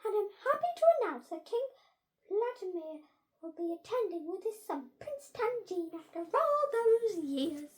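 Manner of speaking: high, loud proclamation to the school; head teacher announcing. A narrator's reading. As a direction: announce it grandly, pitched well up.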